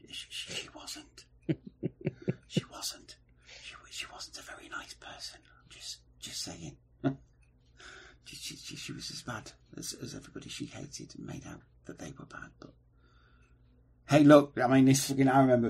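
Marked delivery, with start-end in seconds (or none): 0.1-6.8 hushed whisper. Real, not acted. Whispered aside about Diana. 8.3-12.7 hushed whisper. Real, not acted. Continued whispered gossip about Diana.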